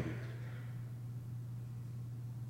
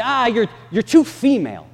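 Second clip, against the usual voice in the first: dismissive church; 8 semitones higher, 7 dB louder than usual. Retold or imitated. Church voicing dismissive excuses.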